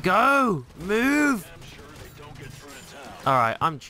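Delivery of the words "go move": urgent shouting. Real, not acted. Urging the squad on.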